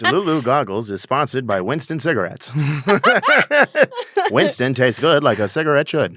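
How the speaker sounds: mock radio ad read; hammed-up vintage announcer; news anchor voice. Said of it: "your news anchor voice".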